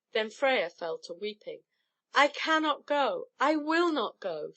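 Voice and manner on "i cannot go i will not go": crying out. Acted. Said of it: "she cried".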